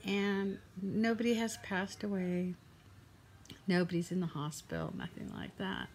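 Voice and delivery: subdued, upset. Real, not acted. Shares upsetting private news.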